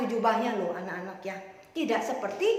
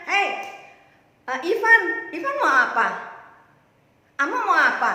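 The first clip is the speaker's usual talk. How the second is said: put-on loud, raised-pitch question to a child; calling out; 5 semitones higher, 9 dB louder than usual. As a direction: hail the child and ask directly. Acts Jesus calling on a child. (memanggil anak dengan suara keras)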